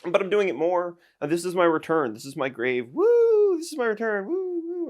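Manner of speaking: high, loud triumphant whoops; excited return. Real, not acted. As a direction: announce your comeback like a champion. Announcing his return.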